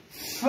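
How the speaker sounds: "f" as a long soft voiceless friction sound; isolated f sound. Shown as a demonstration. Teacher presents the sound to the class, "f" held as voiceless friction. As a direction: sound out "f" by itself, blowing through the consonant. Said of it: "On a le son".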